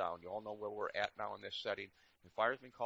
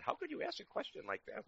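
high, disbelieving question; mock disbelief; incredulous disciples. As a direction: sound amazed at him.

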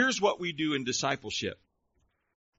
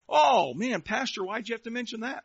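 high-pitched mock grumbling; mock complaint; 7 semitones higher than usual. Congregant objecting to pastor about loving others, mock whining. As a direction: groan the opening, then complain in a raised pitch.

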